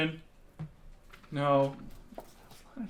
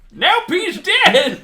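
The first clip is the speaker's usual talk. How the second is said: put-on high, slow cheery old coot; squeaky laughing old prospector; 10 dB louder, much slower than usual. Prospector voiced examining body.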